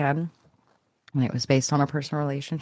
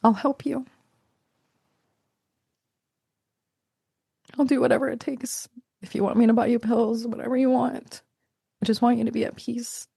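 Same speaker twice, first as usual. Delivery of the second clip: higher, choked-up pleading to her mother; pained, compassionate; 6 semitones higher than usual. Retold or imitated. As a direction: offer help through tears of empathy. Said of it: "from a place of pain and empathy and compassion".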